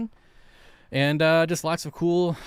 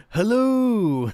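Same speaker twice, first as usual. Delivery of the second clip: higher, louder put-on hello; mock impersonation; 10 semitones higher, 7 dB louder than usual. Streamer laughing at his own impression attempt. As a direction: another bad imitation of a greeting. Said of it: "it's very hard for me to do impersonations".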